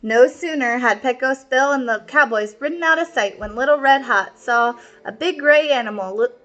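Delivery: ordinary delivery, nothing marked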